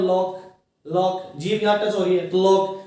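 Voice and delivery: लॉक with the tongue tapping the l; sounding out lock. Shown as a demonstration. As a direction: press the tongue up for लॉक's l. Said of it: "जीभ का use करें अपन".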